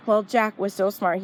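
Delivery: plain reading voice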